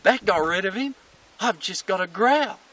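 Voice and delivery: high, loud self-congratulation; triumphant dog. Acted. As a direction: crow as a dog pleased with its discovery. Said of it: "And the dog goes".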